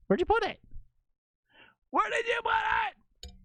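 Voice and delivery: very high-pitched shouted demand; frantic shouting. Real, not acted. Streamer demands where the file was put, high frantic shout. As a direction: shriek the question, desperate for an answer.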